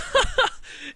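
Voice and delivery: high, loud mocking laugh. Champion laughing at the newcomer, high mocking laugh. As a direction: cackle at the newcomer.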